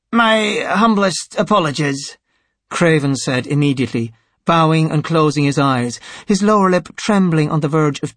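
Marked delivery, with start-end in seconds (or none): 0.1-2.2 high-pitched, humble apology; grovelling sycophant. A narrator's reading. Craven apologising to the High Priest, grovelling. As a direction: apologise abjectly to your superior.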